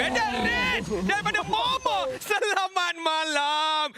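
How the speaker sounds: high, drawn-out hype call; excited call. Acted. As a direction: sing out the kill. Caster calls a kill.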